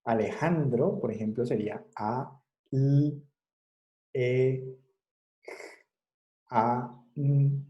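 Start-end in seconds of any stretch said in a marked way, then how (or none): 1.4-7.6 "sería A, L, E, A, N" as bare sounds, one by one; phoneme sounds. Shown as a demonstration. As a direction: sound out each letter of Alejandro slowly. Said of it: "Sonidos, sonidos".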